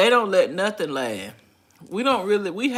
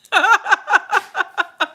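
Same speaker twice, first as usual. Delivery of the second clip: high, loud cackle; high laugh; 11 semitones higher, 7 dB louder than usual. Real, not acted. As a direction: crack up high. Laughing at own joke.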